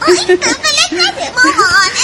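laughing while tickled; giggling. Acted. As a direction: squeal through giggles. Child being tickled.

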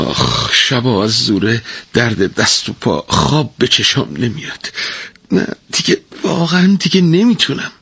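a tired wife moaning her plea; pleading wail. A narrator's reading. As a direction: beg him, exhausted and aching. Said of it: "با تضرع نالید و گفت".

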